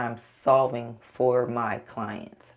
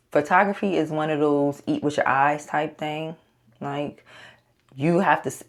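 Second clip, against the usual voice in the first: usual speaking voice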